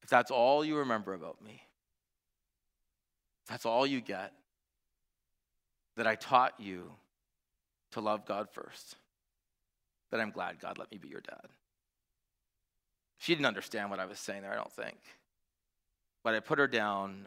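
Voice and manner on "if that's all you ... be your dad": tender fatherly words. Retold or imitated. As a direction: speak gently, full of love. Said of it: "and I said".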